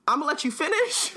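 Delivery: laughing friend mocks Dobby by slipping into [name]; [name] impression. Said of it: "He pulled out the straight [name]".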